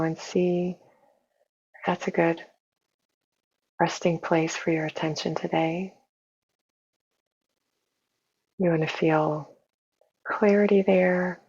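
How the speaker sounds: soft, unhurried meditation voice with long pauses; hushed, even guidance for settling into the body; guided meditation. Real, not acted. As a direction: gently guide listeners inward, leaving space between phrases.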